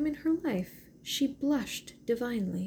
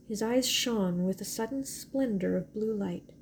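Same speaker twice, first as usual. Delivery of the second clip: ordinary delivery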